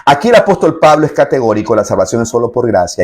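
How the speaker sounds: ordinary delivery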